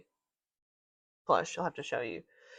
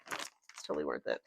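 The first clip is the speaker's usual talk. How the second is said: much lower and much softer repeat; muttered echo; 11 dB quieter than usual. Real, not acted. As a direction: echo it under your breath, low. Repeats the phrase quietly.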